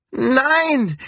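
high-pitched desperate cry; anguished high outcry without much volume; cried out. A narrator's reading. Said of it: "schrie Lorette".

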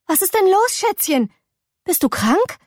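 concerned mother. Acted. Ming asks through the door if May is sick, concerned.

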